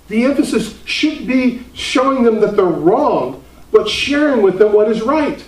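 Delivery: high, loud, driving pulpit voice; full-force preaching. Acted. Preacher urging his church to stop arguing, fervent high preaching. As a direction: preach it hard, pressing the congregation.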